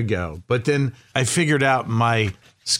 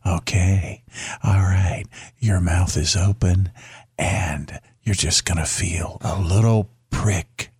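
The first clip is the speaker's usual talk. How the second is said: lightly imitated seductive dentist, slowed down; a hint of a sultry starlet coaxing a patient, slowly; Marilyn Monroe imitation; 4 semitones lower than usual. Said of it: "she talked like this, you know, like Marilyn Monroe".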